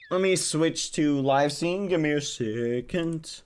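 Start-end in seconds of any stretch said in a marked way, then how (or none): none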